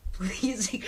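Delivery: breathy whispered please. Acted. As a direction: whisper it like a seductive plea. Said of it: "A creepy, sexy voice".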